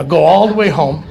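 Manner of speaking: "all" held long, loud. Retold or imitated. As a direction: stretch "all" to show the distance. Exaggerating the long trip.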